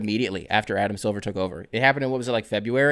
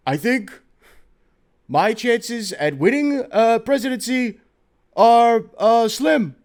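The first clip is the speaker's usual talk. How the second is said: put-on much higher, slow, strained old-politician voice; Bernie Sanders impression; 11 semitones higher, 4 dB louder, slower than usual. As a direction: lean into a creaky Bernie rasp, drawn out. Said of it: "Congressman Bernie Sanders is like".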